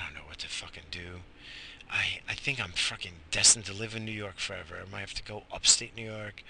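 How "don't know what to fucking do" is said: muttered whisper to himself; a defeated whispered aside. Real, not acted. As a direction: drop to a frustrated whisper. Frustrated aside, unvoiced.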